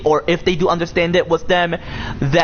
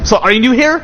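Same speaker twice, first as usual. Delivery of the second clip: excited; 8 semitones higher, 6 dB louder than usual. Real, not acted. Greeting a new viewer.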